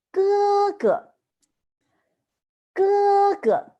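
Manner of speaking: 哥哥 said high and level, both syllables held; teacher model word. Shown as a demonstration. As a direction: model 哥哥 loudly for students. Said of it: "older brother in Chinese is".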